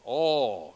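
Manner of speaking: O kept open, lips not closing; open pure O. Shown as a demonstration. Director shows the Latin O to the choir. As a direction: hold O open with no diphthong. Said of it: "But it stays open".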